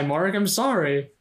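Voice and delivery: ordinary delivery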